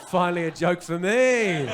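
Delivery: loud, drawn-out mock cheer; audience member's delight. Comedian voicing a man in the crowd, mock delight. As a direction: exclaim it slowly and very loudly. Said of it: "He's like".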